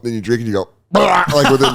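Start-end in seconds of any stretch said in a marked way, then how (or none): 0.9-1.2 high burst of mock gushing; imitated gushing noise. Comedian imitating the colonoscopy drink taking effect, explosive. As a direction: blurt out a comic eruption noise.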